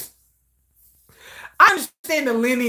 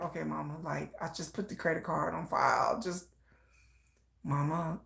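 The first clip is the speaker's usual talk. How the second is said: hushed son; 7 semitones lower, 14 dB quieter than usual. Retold or imitated. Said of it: "He'd be like".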